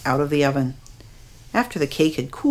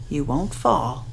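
quiet, gentle reassurance; calm and steady; soft, steady voice; 3 semitones lower, 5 dB quieter than usual. A narrator's reading. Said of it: "Her voice was steady and soft".

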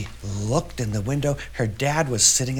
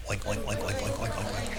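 rapid, hushed oinking; soft pig oinks; 4 semitones lower, 9 dB quieter than usual. A narrator's reading. Girl hears pigs on the school bus, soft oinking. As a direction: chatter quiet oinks like a crowd of pigs.

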